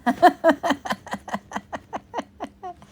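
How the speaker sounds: loud, high laughing fit; loud cackling laugh. Real, not acted. Finding the dying joke hilarious, loud high cackle.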